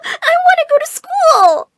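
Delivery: wailing, very high and loud; crying protest. Acted. Daughter wailing at her parents about school, crying. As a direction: sob out the protest.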